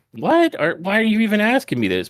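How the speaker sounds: high-pitched mock disbelief; incredulous retort. Sami about to answer Jey's question, incredulous. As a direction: imitate a taken-aback wrestler, pitch leaping up. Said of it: "is about to say".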